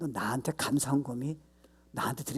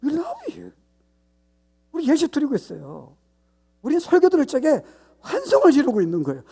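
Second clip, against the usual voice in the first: much higher, louder, shrill mock-cheerful people; mocking the Israelites; 12 semitones higher, 10 dB louder than usual. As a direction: parody shallow worshippers gushing at God. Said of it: "이스라엘 백성이 뭐예요?". (조롱하듯 높고 큰 과장된 목소리)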